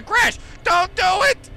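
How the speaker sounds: high, loud plea; desperate shout. Real, not acted. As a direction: beg the game not to crash. Begging game not to crash.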